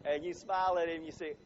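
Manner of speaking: usual speaking voice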